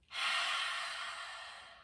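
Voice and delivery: forceful open-mouthed exhale like a roar; lion's breath exhale. Shown as a demonstration. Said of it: "and look behind you like this".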